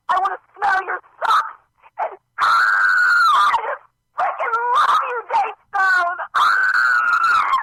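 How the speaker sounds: high, shrieking, teary superfan; screaming fangirl. Acted. Obsessed fan calling Jay Stone on air, shrieking and sobbing. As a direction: lose it completely over your idol.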